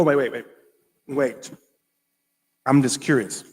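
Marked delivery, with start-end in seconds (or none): none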